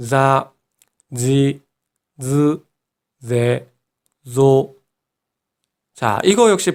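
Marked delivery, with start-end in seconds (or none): 0.1-4.8 자, 지, 즈, 제, 조 with buzzing Japanese z; each syllable separate, starting on a voiced z; voiced z row. Shown as a demonstration. Teacher models the row for learners, voiced z sound in 자, 지, 즈, 제, 조. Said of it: "한 번 더요".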